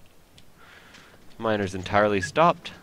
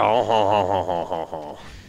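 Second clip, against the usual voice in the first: real laugh. Real, not acted. Laughing at own French joke.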